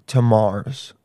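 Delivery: normal talking voice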